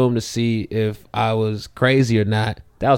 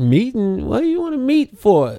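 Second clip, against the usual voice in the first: high-pitched incredulous question; baffled objection; 10 semitones higher than usual. Retold or imitated. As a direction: question the whole idea in disbelief. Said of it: "in my head, I'm like".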